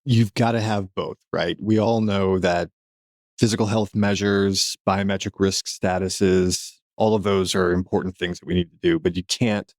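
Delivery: ordinary delivery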